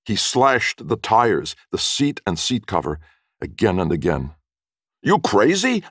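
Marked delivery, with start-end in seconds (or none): 5.0-5.8 high, loud yell of disbelief; shocked, high shout. A narrator's reading. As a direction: yell it in alarm, way up in pitch. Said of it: "Oscar yelled".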